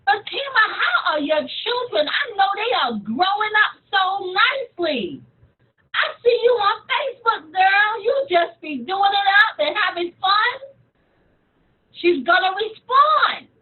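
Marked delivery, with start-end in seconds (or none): 0.0-10.5 high, upbeat friendly chat; excited. Shown as a demonstration. Coach demonstrating small talk with Fatima about children, excited. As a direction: gush with positivity about their family. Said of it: "I'm speaking to her with excitement".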